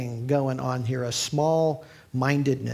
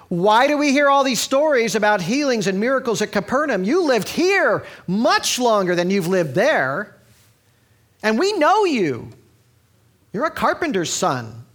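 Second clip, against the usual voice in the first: louder, higher, slowing into a scornful jab; mock the hometown crowd's indignation; a preacher voicing resentful neighbours; indignant townsfolk; 7 semitones higher, 5 dB louder than usual.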